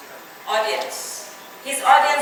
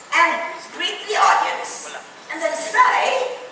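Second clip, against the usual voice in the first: imitated British accent, measured and deliberate; clipped British accent; 2 semitones higher than usual. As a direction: do a starchy clipped British manager. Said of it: "in a very clipped accent".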